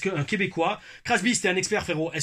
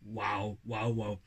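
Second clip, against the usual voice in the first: ordinary talk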